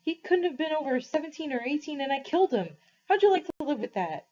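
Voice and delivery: put-on a boy sobbing out his guilt, mildly; crying. A narrator's reading. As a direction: push it out through tears. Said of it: "He was crying".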